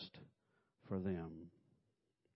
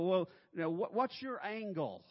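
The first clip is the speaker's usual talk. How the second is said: high, loud suspicious challenge; mock suspicion; 9 semitones higher, 5 dB louder than usual. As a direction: question his motives loudly. Suspicious reply retold.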